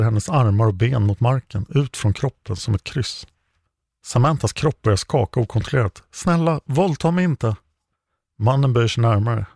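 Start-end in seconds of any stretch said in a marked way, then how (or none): 6.1-7.6 begging, pitched higher, shaking with fear; a frightened captive begs her kidnapper not to hurt her; terrified pleading. A narrator's reading. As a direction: plead for your life, voice climbing in panic.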